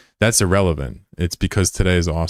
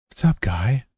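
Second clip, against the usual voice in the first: silly greeting voice; 5 semitones higher, slower than usual. Acted. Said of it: "don't know why I'm talking like that".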